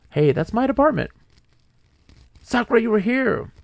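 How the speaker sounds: high, loud excited outburst; delighted surprise, voice jumping up. Real, not acted. Finds own department in game.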